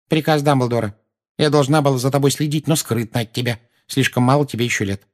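put-on agitated, apologetic old lady; fretful old woman. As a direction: explain yourself like a fussy old neighbour.

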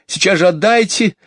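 put-on high, urgent child's voice; agitated demand. Jane wants the parcel back, high and agitated. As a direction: demand it back, all worked up. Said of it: "вся тряслась от возбуждения".

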